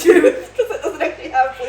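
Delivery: loud laughter breaking into the words; amused, giggling through it; laughing explanation. Real, not acted. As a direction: crack up at your own makeshift setup. Laughing at own jerry-rigged car setup.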